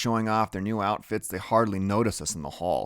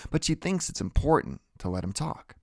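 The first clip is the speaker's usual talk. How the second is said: ordinary delivery, nothing marked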